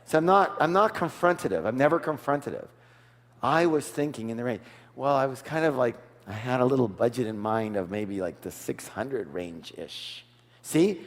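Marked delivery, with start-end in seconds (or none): 3.4-10.2 much lower and softer, hesitant; downplay the offer so nobody feels pushed; soft and humble. Shown as a demonstration. Coach showing the buyer's humble counteroffer, soft and low. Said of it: "Range-ish doesn't say, I'm stuck here".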